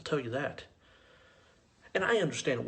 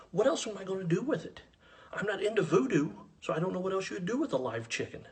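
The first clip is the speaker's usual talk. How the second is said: higher-pitched, baffled comeback; exasperated protest; 7 semitones higher than usual. Retold or imitated. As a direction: protest at the absurd offer, pitch up. Argues against taking live chicken.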